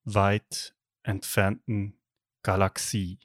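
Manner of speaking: slow, hushed fairy-tale opening; epic fantasy narrator. Acted. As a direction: intone it like a movie opening. Star Wars opening parody. (epischer Märchenerzähler)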